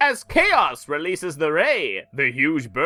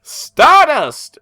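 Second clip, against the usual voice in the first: dramatic announcer; 10 semitones higher, 10 dB louder than usual. Acted. Reading comic narration with gusto.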